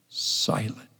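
Silent, voiceless and soft; whispered. Shown as a demonstration. Preacher echoing silent in a whisper.

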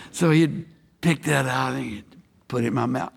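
ordinary talk

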